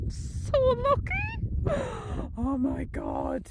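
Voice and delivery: squealing high with a gasp of delight; excited. Real, not acted. Gazing at the sunset view, squealing with excitement. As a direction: burst into high-pitched delight, then gasp. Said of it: "I just get so excited about stuff like this".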